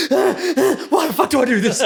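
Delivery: high, gasping mock exhaustion; hammed-up out-of-breath whine; huffing and puffing. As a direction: act winded and complain between gasps. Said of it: "huffing and puffing for twenty minutes going".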